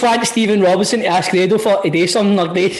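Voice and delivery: usual speaking voice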